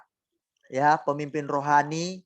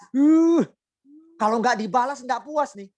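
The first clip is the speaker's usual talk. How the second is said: mock indignant grumble; 10 semitones higher, 4 dB louder than usual. Preacher acting out vengeful spouse.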